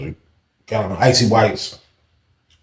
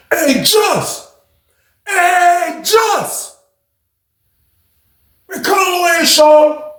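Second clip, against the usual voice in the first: calling out; much slower than usual. Retold or imitated. Fred shouting out the door for Just. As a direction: yell for him to come over.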